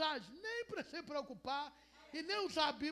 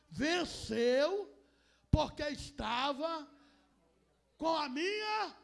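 loud slow boast; 3 semitones higher, 10 dB louder, much slower than usual. Acted. Voices lender boasting.

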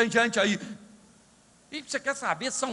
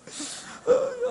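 high laugh; 14 semitones higher than usual. Real, not acted. Laughing at himself crying.

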